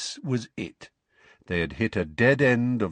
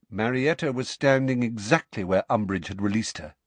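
ordinary delivery

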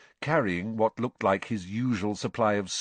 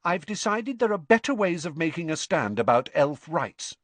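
haughty; 7 semitones higher, 3 dB louder than usual. A narrator's reading. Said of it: "said Hermione haughtily".

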